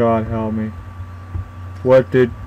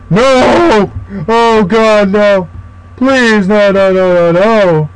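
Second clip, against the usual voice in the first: higher, rushed cries of no; panicked pleading; 4 semitones higher, much slower than usual. Real, not acted. Player chased by the monster, begging in terror. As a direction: beg for your life, terrified.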